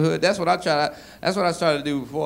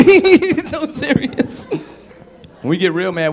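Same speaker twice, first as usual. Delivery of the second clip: ordinary talk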